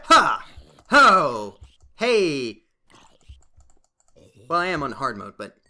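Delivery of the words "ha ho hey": loud short grunts with each swing; battle cries. Real, not acted. Player testing his new sword, battle cries. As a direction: bark each one like a sword strike.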